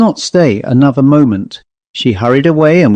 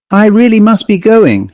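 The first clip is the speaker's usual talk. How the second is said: higher, pressing to get away; eager to leave, a touch louder and hurried; 8 semitones higher, 3 dB louder than usual. A narrator's reading. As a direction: rush the excuse out. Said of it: "she said hurriedly".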